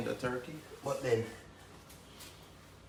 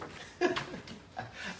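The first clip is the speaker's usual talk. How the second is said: laughing high and airy; high breathy laugh; 13 semitones higher, 4 dB quieter than usual. Real, not acted. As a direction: break into a giggle. Laugh within quote.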